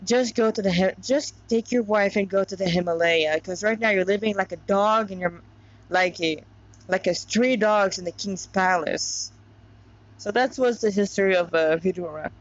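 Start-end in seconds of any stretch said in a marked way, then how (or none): 0.0-9.3 reading a story aloud; steady storyteller voice; story narration. A narrator's reading. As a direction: narrate the tale evenly, like a reader.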